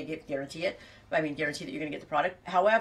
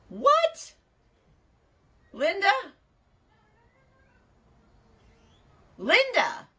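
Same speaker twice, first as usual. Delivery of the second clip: shocked disbelief; 6 dB louder, much slower than usual. Real, not acted. Shocked by viewer's bill.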